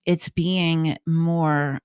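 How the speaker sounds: ordinary talk, nothing marked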